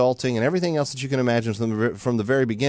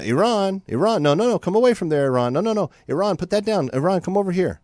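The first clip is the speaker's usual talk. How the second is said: put-on high, singsong calling to a naughty child; pitched-up, pleading mock reprimand; mock parental scolding; 8 semitones higher than usual. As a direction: play a parent shooing a toddler away.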